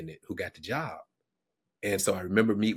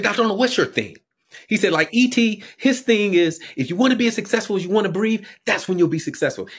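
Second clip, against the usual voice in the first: much higher and louder, fired up; excited quote; 12 semitones higher, 12 dB louder than usual. Retold or imitated. Student excitedly challenging Doctor Arnold, loud and high. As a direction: belt it like an eager young man pitching.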